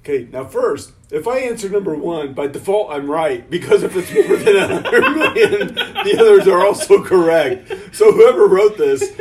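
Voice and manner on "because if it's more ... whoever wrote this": talking through rising laughter, loud; laughing. Real, not acted. Player mocks the flawed quiz answers, laughing loudly. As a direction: crack up while making the point. Amused mocking of the question's logic.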